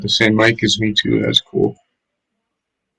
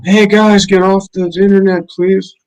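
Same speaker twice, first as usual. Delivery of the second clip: high, raised call to the household; pitched-up, louder imitation of yelling at housemates; mock yell; 10 semitones higher, 4 dB louder than usual. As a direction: yell it out as if to people in another room. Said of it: "I like to mute and just yell".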